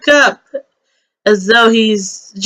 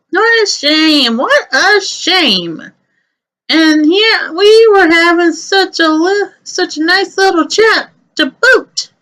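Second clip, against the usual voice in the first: a higher, sing-song chatterbox rabbit; chatty Aaron voice; 6 semitones higher than usual. Acted. Chatty rabbit seeing the kids off with a higher sing-song voice. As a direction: gush politely while shooing them off.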